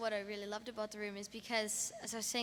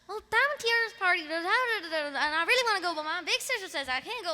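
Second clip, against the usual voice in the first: high, loud and hurried recital; recited character lines; 9 semitones higher, 6 dB louder, faster than usual. Acted.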